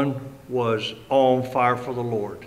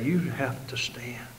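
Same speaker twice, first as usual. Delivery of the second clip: slow, hushed whisper; urgent whisper; 9 dB quieter, slower than usual. Real, not acted. Preacher urging the congregation to stand, hushed whisper. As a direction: drop to a whisper to press it home.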